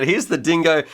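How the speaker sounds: ordinary talk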